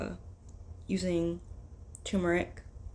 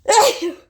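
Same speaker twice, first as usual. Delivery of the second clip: loud high real cough; coughing fit; 15 dB louder than usual. Real, not acted. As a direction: cough hard, choking on it. Turmeric burning the nose.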